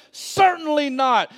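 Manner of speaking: slow, high, forceful "Certainly not"; "Certainly not" proclaimed slowly and high; great emphasis. Acted. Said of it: "with great emphasis".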